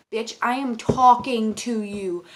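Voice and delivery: loud, slow, emphatic telling-off; indignant scolding. Acted. Cashier to customer who ignores greetings, indignant. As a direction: space the words out like a demand for attention.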